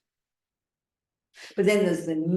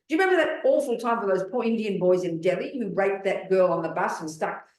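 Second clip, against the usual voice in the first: ordinary talk, nothing marked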